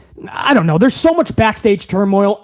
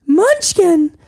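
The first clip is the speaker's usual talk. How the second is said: much higher, thin Munchkin; 7 semitones higher than usual. Pretend. Underling saying Munchkin before the boss corrects him, high. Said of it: "put some bass in your voice".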